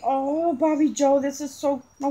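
ordinary delivery

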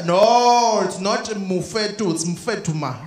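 drawn-out put-on correction; slow, heavy-accented correction; exactly who she expects. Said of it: "be exactly who she thinks I am".

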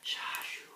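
soft whispered wind whoosh; quiet, airy wind noise; whining wind. A narrator's reading. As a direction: hush it out like wind. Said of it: "whines the wind".

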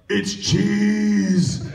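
high, loud triumphant shout of cheese; excited character cry. Retold or imitated. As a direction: blurt it out like a sudden discovery. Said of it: "I like".

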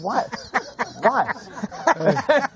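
high, puzzled double take; confused reaction. Retold or imitated. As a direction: act baffled, pitch up.

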